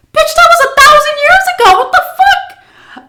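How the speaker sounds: high, loud outraged yell; outraged shout. Real, not acted. Storyteller yelling at the old driver's claim. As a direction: shriek it in disbelief. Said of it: "her own outraged reaction now".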